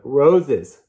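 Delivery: ordinary delivery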